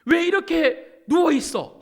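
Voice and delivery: loud, high-pitched mock rebuke from God; acted-out furious telling-off; angry scolding. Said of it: "화내신 게 아니에요".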